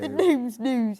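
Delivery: slow, drawn-out West Country voice; West Country accent. Pretend. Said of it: "done in your West Country accent".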